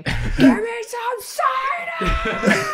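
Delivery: high, slow, laughing scream; mock drunk girl screaming for cider. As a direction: wail it at the top of your lungs, laughing. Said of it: "screaming at the top of her lungs".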